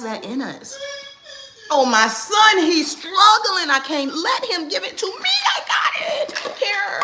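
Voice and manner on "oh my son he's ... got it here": high, loud fussing mom voice; hammed-up smothering mother rushing in; mock overhelpful mom.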